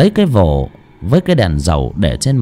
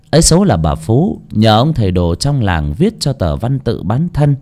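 plain reading voice

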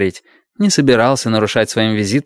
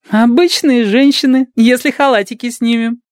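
put-on playful young nurse; 14 semitones higher, 4 dB louder than usual. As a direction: tease with a giggle in it. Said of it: "и захихикала так".

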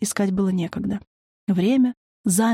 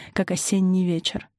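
ordinary delivery

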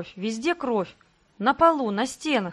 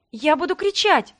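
frightened threat; 7 semitones higher, 5 dB louder than usual. Acted. Cornered woman threatens to scream.

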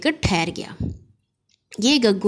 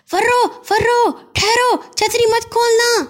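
loud call; 14 semitones higher, 3 dB louder than usual. Acted. Gaggu loudly calls his sister to stop. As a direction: call her from down the lane. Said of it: "زور سے کہا".

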